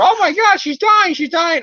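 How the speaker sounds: high, loud panicked squeal; mock panic. Characters rushing into May's room, high panicked squeal. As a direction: shriek it like a frantic hysteric. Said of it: "freaking out".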